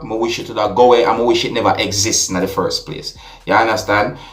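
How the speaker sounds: ordinary delivery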